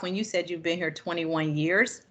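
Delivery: ordinary talk, nothing marked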